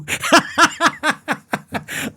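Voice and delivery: high wheezing laughter. Real, not acted. Recalling the sister's warning, he laughs high. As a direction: cracks up high.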